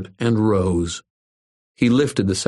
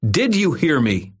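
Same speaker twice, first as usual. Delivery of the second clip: angry; 9 semitones higher than usual. A narrator's reading. Irwin confronts bully.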